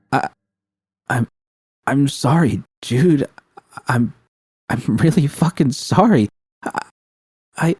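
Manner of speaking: shaky. A narrator's reading. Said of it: "V's voice grew shaky".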